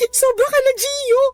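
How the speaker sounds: high, crying accusation; hurt girlfriend crying at her boyfriend; tearful outburst. Acted. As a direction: voice breaking as she accuses him.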